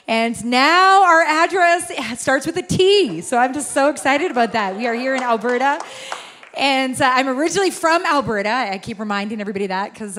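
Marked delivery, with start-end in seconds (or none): none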